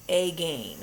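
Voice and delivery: "again" said as "ay-gain", long A in both syllables; misread as A-gain. Shown as a demonstration. Teacher showing pupils what the sight word looks like, "again" as "ay-gain". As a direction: read "again" by its spelling, "AY-GAIN". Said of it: "It kinda looks like".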